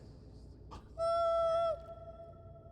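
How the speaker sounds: startled squeaky intake of breath; sharp high gasp. Real, not acted. Player spotting something in the game, gasps high.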